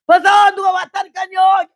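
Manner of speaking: put-on noisy young wife shouting for her husband; full yell. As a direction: bellow for the husband at full force. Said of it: "소리 지르는 건 내가 얼마나 시끄러운 여잔지요".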